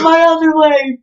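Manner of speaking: high, loud hammed-up cry of pain; mock wail. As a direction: fake a dramatic fall. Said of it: "he fell and he's like".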